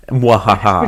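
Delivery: evil villain cackle, lightly done; a quick mock evil laugh; mad scientist. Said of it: "who's just is like".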